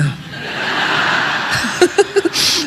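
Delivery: airy, voiceless giggle; breathless laugh. Real, not acted. Preacher laughs softly at her own joke to the congregation.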